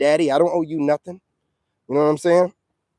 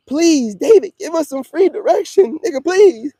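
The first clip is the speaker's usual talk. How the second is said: much higher, whiny mock plea; mock begging; 12 semitones higher, 4 dB louder than usual. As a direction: parody the critics begging for free help. Mocking critics begging for direction.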